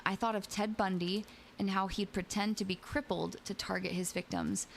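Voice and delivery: usual speaking voice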